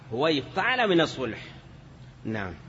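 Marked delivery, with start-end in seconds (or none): none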